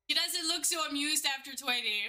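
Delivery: ordinary talk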